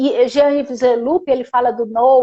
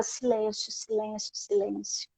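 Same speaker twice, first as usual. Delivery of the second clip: very soft, lowered murmur of the word; hushed repetition; 3 semitones lower, 15 dB quieter than usual. Retold or imitated. Recalling the silence signs at the well, hushed. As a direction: repeat it softly, as if not to disturb.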